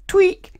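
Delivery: loud squeaky tweak noise; high squeaky tweak. A narrator's reading. Sound effect.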